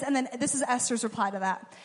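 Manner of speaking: ordinary talk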